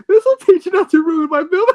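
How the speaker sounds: high, cackling through words; gloating villain laugh. Acted. Villain gloats after wrecking film.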